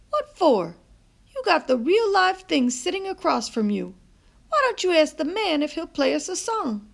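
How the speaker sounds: playful grandma urging; grandmother voice. A narrator's reading. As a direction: nudge the boy toward the music. Nana urges CJ.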